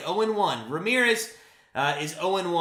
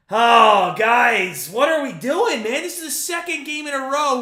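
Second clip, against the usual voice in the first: loud, higher frustrated shout at the team; exasperated outburst; 6 semitones higher, 7 dB louder than usual. Real, not acted. Fan streamer vents at his losing team, real exasperated shout. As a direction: yell your disbelief at the lineup.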